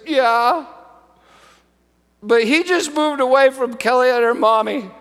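a hint of a sad child's voice; lightly voiced girl, still teary; tearful little girl. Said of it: "And Elizabeth said".

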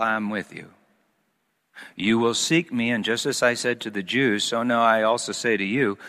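low, measured Bible reading voice; scripture reading. Acted. Reading scripture aloud, held low.

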